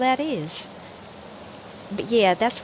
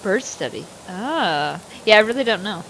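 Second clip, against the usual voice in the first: ordinary talk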